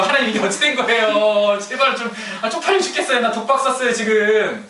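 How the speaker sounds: high, laughing mock plea to God; pleading whine. Moses begging God after taking the blame, mock whine. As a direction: whine like a cornered man begging for help.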